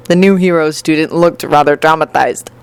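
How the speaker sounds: ordinary delivery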